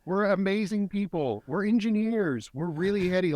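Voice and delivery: high-pitched, upbeat acted boasting; grateful pride. Coach modelling gratitude as a proud German, upbeat. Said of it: "is to be grateful".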